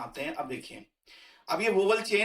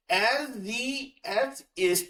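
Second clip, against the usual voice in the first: er sie es ist drawn out very slowly, pitched higher; slow demo; 5 semitones higher, much slower than usual. Shown as a demonstration. Teacher recites the conjugation slowly for learners. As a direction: model the conjugation syllable by syllable. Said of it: "तो क्या रहा?".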